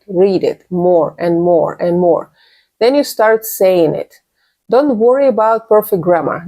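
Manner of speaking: usual speaking voice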